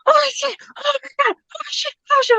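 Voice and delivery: tiny, very high frightened whimper; acted-out panic, high and thin; retold panic. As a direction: squeal softly in mock terror. Retold panic in dark store.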